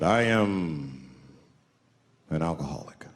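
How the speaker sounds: low, slow solemn admission; grave confession. Retold or imitated. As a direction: deliver it gravely. Stoic man, grave punchline.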